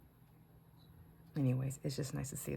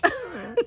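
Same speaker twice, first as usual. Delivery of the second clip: burst of laughter; 14 semitones higher, 7 dB louder than usual. Real, not acted. Laughing at grandson's line.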